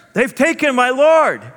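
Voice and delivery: high, loud grieving cry; Mary grieving. Acted. Mary answering the gardener in grief, high and loud. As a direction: cry it out to the stranger.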